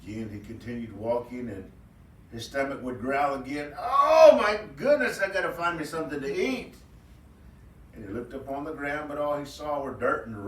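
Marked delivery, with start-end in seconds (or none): 3.8-6.8 high, excited rabbit voice, stretched oh; a hungry rabbit clutching his growling belly; hungry trickster rabbit. Acted. Hungry trickster rabbit cries out to himself, high and excited.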